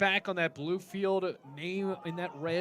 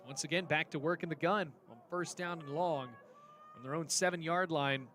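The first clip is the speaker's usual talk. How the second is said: ordinary delivery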